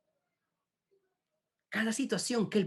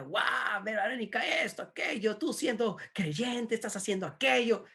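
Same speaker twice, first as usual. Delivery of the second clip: raised pitch, no real volume; mock scolding yell; 6 semitones higher than usual. Sister catching Verónica at the market, mock yelling. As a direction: imitate a scandalised public telling-off. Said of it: "y Ana le grita".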